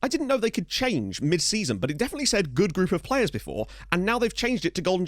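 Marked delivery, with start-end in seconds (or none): none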